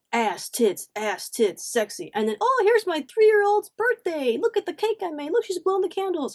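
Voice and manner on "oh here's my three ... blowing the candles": high, sing-song proud mom gushing; mocking mom post. Mocking her mom posts in a high gushing voice. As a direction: squeal like a proud mom showing off. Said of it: "it'd be like".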